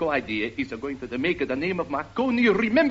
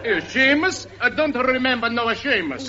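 high puzzled Italian voice; Italian accent; 7 semitones higher than usual. Acted. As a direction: query the name in a hammy Italian accent.

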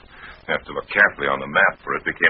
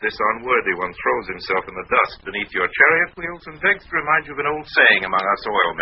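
lightly parodied flowery courtier, pitched up; mock-ceremonious homage to a rival; mock vizier; 6 semitones higher, 4 dB louder than usual. Lime parodies vizier's flowery speech.

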